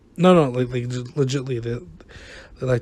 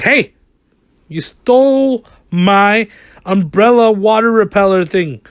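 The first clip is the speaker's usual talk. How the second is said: loud, higher, slow put-on accusing voice; accusing game character; 6 semitones higher, 8 dB louder, slower than usual. Game character accusing the player of theft, loud put-on voice. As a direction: act out an indignant villager.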